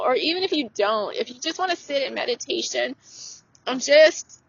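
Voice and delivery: ordinary talk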